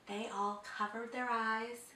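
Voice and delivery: plain reading voice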